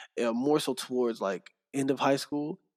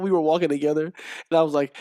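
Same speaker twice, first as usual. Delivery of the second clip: ordinary talk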